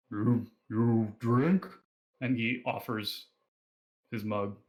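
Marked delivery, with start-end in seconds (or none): 0.1-1.7 slow, halting drink offer; lightly voiced half-troll, drawn-out words; hesitant half-troll. Half-troll offering his mug to a newcomer, hesitant. Game master voices Hork.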